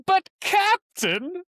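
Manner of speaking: high, slow, loud Tim Curry impression; Tim Curry line. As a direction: stretch it out high like the political officer. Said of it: "let me try my favorite line of his".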